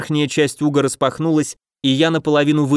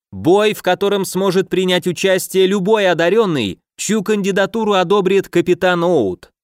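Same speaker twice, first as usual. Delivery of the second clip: arena announcer; 6 semitones higher than usual. A narrator's reading. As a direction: hype up the crowd. Announcer presenting the fighters. (торжественный голос ведущего)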